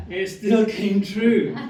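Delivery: high, loud, slow laughing disbelief; laughing punchline. Real, not acted. Comedian laughing at her own punchline. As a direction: laugh the words out at the twist.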